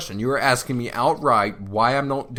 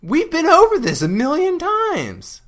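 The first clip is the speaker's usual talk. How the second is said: exasperated; 6 dB louder, slower than usual. Real, not acted. Exasperated outburst in argument.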